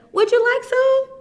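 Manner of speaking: high sweet voice. Acted. Amu voiced, offering stew.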